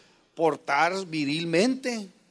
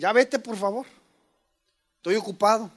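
impatient brush-off, a bit louder; irritated brush-off; 2 semitones higher, 4 dB louder than usual. Retold or imitated. Father brushing off a curious daughter, impatient. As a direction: shoo her away, busy. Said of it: "a veces le digo".

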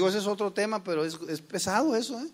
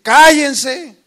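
sudden loud bark, pitched way up; shouted order; 7 semitones higher, 17 dB louder than usual. Shown as a demonstration. Father silencing his household, a loud shouted command. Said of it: "A veces tienes que decir".

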